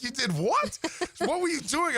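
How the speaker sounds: high, incredulous wife; wife hearing the news, mock shock. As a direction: shoot the questions up in disbelief. Wife reacts to the torn bicep.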